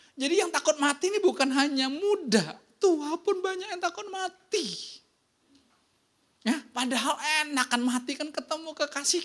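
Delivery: high, driving sermon voice; fervent preaching. Acted. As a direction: preach it out to the whole room. Preaching at full force. (berkhotbah dengan semangat penuh)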